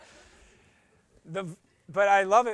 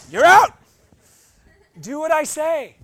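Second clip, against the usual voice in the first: angry mom; 8 semitones higher, 12 dB louder than usual. Retold or imitated. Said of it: "now you all understand what hell is".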